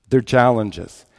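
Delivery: ordinary delivery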